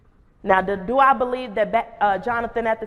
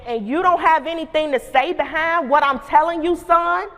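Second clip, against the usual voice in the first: higher, demanding challenge; sassy scolding; 6 semitones higher than usual. Retold or imitated. Principal pressing Jonathan for an answer, higher and demanding. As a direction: dare him to talk back.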